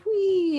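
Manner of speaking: high, drawn-out "whee"; playful whee. Acted. Host jokes about flying into a tangent, gleeful whee. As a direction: let out a sliding playful whee.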